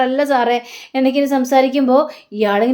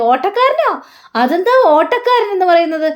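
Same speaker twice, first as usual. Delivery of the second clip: surprised question; 6 semitones higher than usual. A narrator's reading. Owner puzzled by odd nickname.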